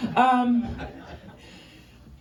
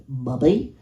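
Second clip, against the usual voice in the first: put-on nasal Chicago kid voice; 9 semitones lower than usual. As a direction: pinch it through the nose. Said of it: "I have a nasally voice".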